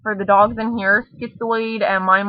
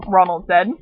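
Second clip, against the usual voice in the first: usual speaking voice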